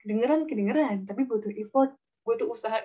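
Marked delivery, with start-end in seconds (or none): none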